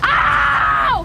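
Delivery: sharp loud cry of pain; pained yelp. Real, not acted. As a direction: yelp as it hurts. Pain while breaking food.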